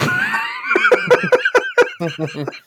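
bursting out laughing; big hearty laugh. Real, not acted. Derailing joke, laughing loud.

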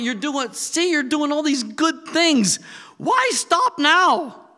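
high, loud, excited Peter voice; Peter's pleading rant. Retold or imitated. Peter urging Jesus to keep going, excited and loud. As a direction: hype him up like an eager fan. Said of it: "saying to him".

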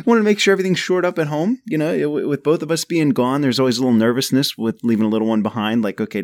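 ordinary delivery

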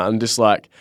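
ordinary talk

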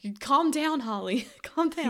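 high, scolding "calm down"; scolding body voice. Retold or imitated. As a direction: play her body telling her off. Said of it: "now your body is like".

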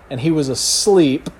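ordinary talk, nothing marked

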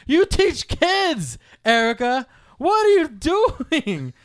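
high, loud, drawn-out retold scolding; exasperated yell. Brother scolding his kindergarten-teacher sister, exasperated yell. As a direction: stretch out the words in appalled disbelief.

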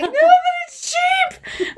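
very high, slow, pleading; insistent gushing. Retold or imitated. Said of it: "We're like".

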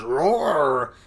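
imitated growling roar; bear roar. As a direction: roar like a pouncing cartoon bear. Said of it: "a bear jumps out and goes".

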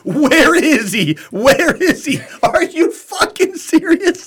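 very high, slow, laughing panicked cry; mock frantic officer. Mocking the officer after she fired at nothing, high slow laughing cry. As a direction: drag it out, laughing at her panic. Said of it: "she starts yelling".

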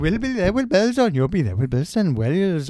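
mock Welsh sign-off, big and bouncy; Welsh accent. Podcast host signs off to listeners in a loud mock Welsh accent. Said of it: "I'll just do a Welsh accent".

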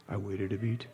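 usual speaking voice

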